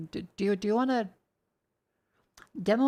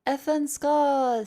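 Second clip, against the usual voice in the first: excited shout-out; 6 semitones higher, 5 dB louder, much slower than usual. Real, not acted. Thanking a subscriber on stream.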